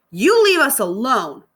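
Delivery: slow, high, loud warning; defiant warning. Acted. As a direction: tell them to back off, firmly. Girl defends dog angrily.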